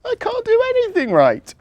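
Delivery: high little elf voice with a touch of tears; mild sobbing elf. A narrator's reading. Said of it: "she sobbed".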